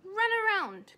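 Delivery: loud, high command. Acted. Charlotte orders Wilbur to run, loud command. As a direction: bark the order like a coach. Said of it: "commanded Charlotte".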